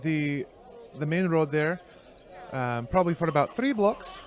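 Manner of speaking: faltering Irish female voice; high-pitched Irish woman. Acted. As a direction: give directions in a shaky stab at an Irish woman. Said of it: "high-pitched Irish female voice".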